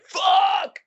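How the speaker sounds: high, loud frightened yell; terrified scream. Retold or imitated. The boy runs from La Llorona with a high terrified yell. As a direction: scream it in panic. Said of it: "he's like".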